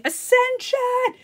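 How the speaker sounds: high, drawn-out crazed cry; hammed-up ecstatic shriek, stretched long; mock screaming. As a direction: scream it out like a raving zealot. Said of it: "running around naked outside screaming".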